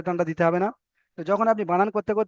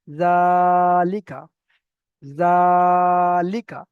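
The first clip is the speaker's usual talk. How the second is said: জালিকা with জা held long and loud, লিকা short; sustained জা on one pitch in জালিকা, no stretch on লি or কা; long ZA, short likā; 4 dB louder, much slower than usual. Shown as a demonstration. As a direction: stretch the first syllable of জালিকা, then release লিকা briefly. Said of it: "লিটা একদম টান দেওয়া যাবে না".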